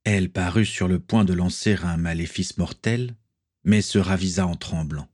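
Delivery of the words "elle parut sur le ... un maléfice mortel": usual speaking voice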